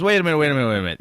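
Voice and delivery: imitated cut-in, high and loud; urgent interruption. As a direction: jump in loudly to halt someone.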